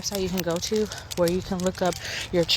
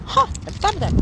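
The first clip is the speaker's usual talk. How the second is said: mock realization; 14 semitones higher, 4 dB louder, faster than usual. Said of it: "made you go".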